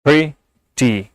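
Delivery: Pretty broken in two, pri, pause, ti; pretty in two separate beats; split into syllables. Shown as a demonstration. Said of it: "दो syllable हैं".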